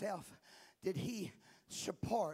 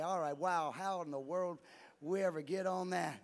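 ordinary talk, nothing marked

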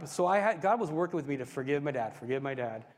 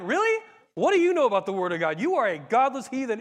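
raised, indignant retort; yelling back; 6 semitones higher, 6 dB louder than usual. Retold or imitated. Son to dad about the Word of God, mock yelling back. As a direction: act out a self-righteous teen firing back. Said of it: "and I'm yelling at him".

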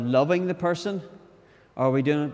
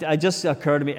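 ordinary talk, nothing marked

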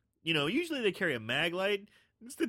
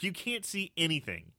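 ordinary delivery

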